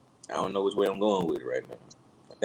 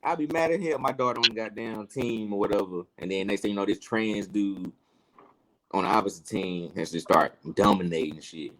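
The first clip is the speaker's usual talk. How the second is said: usual speaking voice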